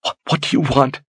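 panting. Acted. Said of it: "she panted".

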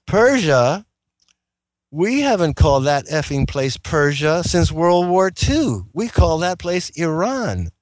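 an outraged retort to an obvious lie; indignant retort. Retold or imitated. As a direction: snap back in disbelief. Retold outburst at the liar.

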